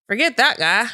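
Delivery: a hint of a bratty Cartman. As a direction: toss it off like a bratty kid. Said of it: "Is that Eric Cartman?".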